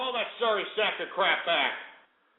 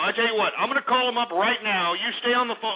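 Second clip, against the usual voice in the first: ordinary delivery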